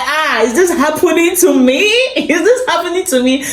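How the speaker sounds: high-pitched giddy disbelief breaking into a laugh. Retold or imitated. Friends send her money, she squeals in disbelief. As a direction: squeal it like you can't believe your luck. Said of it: "I was like".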